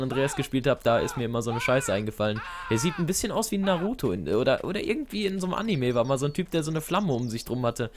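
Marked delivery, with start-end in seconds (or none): none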